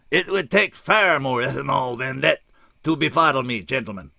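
weary correction of the doubters; tired alien. A narrator's reading. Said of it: "looked tired and said".

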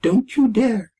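high-pitched squeaky warning; mock threat. A narrator's reading. Retold threat from the small voice.

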